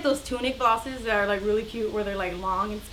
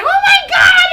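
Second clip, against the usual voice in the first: very high, loud panicked scream; 12 dB louder than usual. Retold or imitated. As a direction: imitate a woman shrieking in horror.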